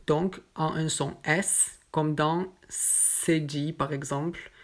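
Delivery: normal talking voice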